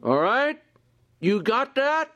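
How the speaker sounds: high, slow stern father laying down the law; stern dad. Retold or imitated. Dad to child in real trouble, stern and high. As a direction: talk down to a kid in trouble. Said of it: "and he says".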